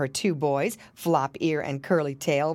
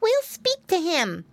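high, slow, resolved piglet; piggy boy; 13 semitones higher than usual. A narrator's reading. Piglet deciding to approach Santa, high resolved voice. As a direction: squeak it out deliberately.

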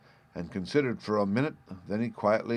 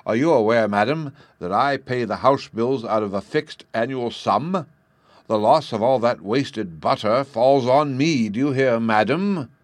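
angry scolding; 4 semitones higher, 7 dB louder than usual. Acted. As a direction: hammer each complaint at madam. Father scolds wife over butter.

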